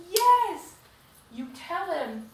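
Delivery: high, loud cheering yes; excited cheer. Real, not acted. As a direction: shout your approval at the page. Cheering the heroine on.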